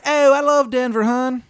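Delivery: loud, high, exaggerated Maryland twang; terrible Maryland accent. Baba Yaga greeting a newcomer, bad Maryland accent. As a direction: holler in a hammed-up Maryland accent. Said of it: "accent is like nails on a fucking chalkboard".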